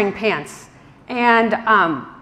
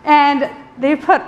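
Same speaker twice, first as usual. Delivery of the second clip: laughing through words; 5 semitones higher, 4 dB louder than usual. Real, not acted. Still laughing while narrating.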